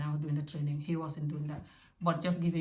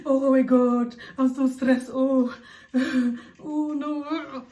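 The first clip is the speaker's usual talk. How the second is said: higher, louder mock panic, sighing; mock stressed panic; 6 semitones higher, 5 dB louder, much slower than usual. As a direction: act the frazzled mum, hamming it up. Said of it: "Don't focus on the cry now and be like".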